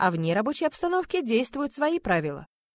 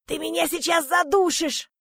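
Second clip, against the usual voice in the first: high, slow strangled voice; choked rasp; 9 semitones higher than usual. A narrator's reading. Man in the heroine's stranglehold, choking and hoarse. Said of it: "прохрипел Андрей".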